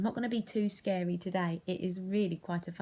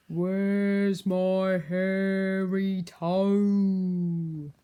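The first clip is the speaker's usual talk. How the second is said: very slow, louder haunting wail; ghostly cry; 10 dB louder, much slower than usual. Acted. Ghost seeking its toe, slow haunting wail. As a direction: call it from far away. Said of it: "a voice crying".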